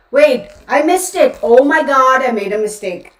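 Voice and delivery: loud, high burst of alarm at her own mistake; oh no, I got the day wrong; sudden realization. Real, not acted. Realizes wrong episode topic.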